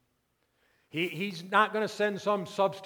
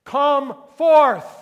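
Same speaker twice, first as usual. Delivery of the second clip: very loud, high-pitched summons; shouted command; 10 semitones higher, 18 dB louder than usual. Acted. Preacher voicing the Lord's command to the dead, shouting. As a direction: bellow it at full force, each word held. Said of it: "this shout is that very command".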